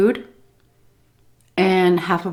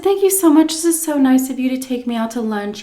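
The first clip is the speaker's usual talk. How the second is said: much higher, sweet, grateful; overly polite thanks; 6 semitones higher than usual. Retold or imitated. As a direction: gush thanks to the waitress. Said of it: "So I said".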